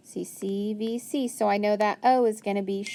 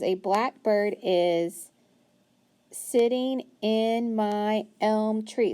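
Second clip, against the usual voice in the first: slow rereading; much slower than usual. Shown as a demonstration. Teacher rereading to find the answer, slowly. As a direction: read back slowly, word by word. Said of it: "let's go back and see".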